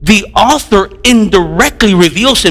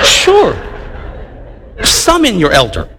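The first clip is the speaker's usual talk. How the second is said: corrected polite take. Shown as a demonstration. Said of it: "I don't talk like that".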